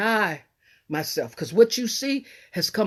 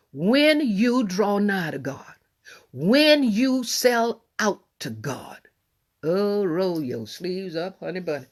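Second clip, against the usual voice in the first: stretched-out pulpit cadence, each phrase lingered; slow drawn preaching; much slower than usual. Acted. Slow preaching build to congregation.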